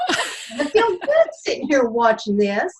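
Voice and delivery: amused, laugh in the voice. Acted. Client happily answers the coach's question, laughing.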